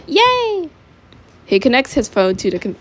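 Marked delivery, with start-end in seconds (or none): 0.1-0.7 high-pitched celebratory yay; yelled cheer. A narrator's reading. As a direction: cheer in a much higher voice. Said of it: "Jacinto yells".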